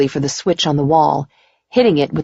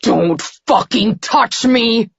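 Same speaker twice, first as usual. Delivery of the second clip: high, slow, strained threat; hissed warning; 7 semitones higher, slower than usual. A narrator's reading. Man still in his nightmare, hissing at her. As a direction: force each word out in tormented fury. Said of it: "He hissed".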